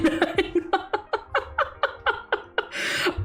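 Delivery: high breathy burst of laughter; real laugh. Real, not acted. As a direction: crack up at the memory. Bursts out laughing at own story.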